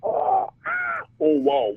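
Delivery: high, loud imitation of a sampled "wow"; playful high-pitched sampler imitation; mimicking a synth sample. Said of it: "that's Danny jamming on the".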